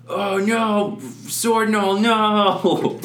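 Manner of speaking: high, loud grief-stricken wail; anguished cry. Acted. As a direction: cry out for a fallen friend. DM voices a grieving gnoll.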